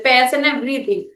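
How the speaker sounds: usual speaking voice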